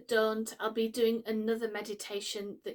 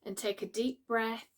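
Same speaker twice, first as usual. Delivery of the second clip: calm, unhurried meditation voice with long pauses; guided meditation. Acted. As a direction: gently guide the listener into relaxation, pausing between phrases.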